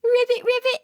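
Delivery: high, loud, playful ribbits; frog call. Pretend. Answering what a frog says for little kids, playful ribbit. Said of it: "What does a frog say?".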